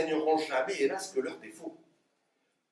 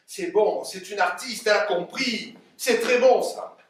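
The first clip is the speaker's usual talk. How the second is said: rising, loud praise; excited approval; 4 semitones higher, 7 dB louder than usual. Acted. Father praises his daughter as a true artist, excited. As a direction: build to a proud exclamation.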